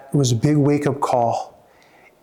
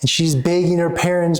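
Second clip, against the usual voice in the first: usual speaking voice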